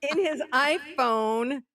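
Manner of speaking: high, drawn-out exclamation; excited reveal. Retold or imitated. Storyteller revealing [name]'s recording to the audience, excited reveal. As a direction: relish every word of the big reveal.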